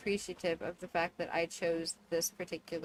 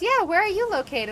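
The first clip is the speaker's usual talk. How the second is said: high, loud imitation of the caller; hammed-up questioning; 12 semitones higher, 9 dB louder than usual. Caller asks agent where he is, hammed-up questioning. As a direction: fire it back big and pitched up. Acting the escalating exchange.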